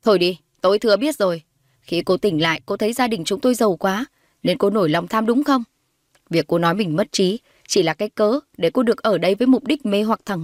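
lightly voiced hostile mother, pressing her accusation; acted, firm scorn; scornful accusing mother. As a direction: accuse her with rising contempt.

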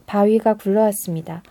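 usual speaking voice

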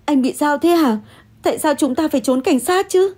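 high-pitched, upset questioning; agitated demand. Acted. Refuses to flee, demands explanation.

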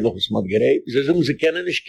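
ordinary talk

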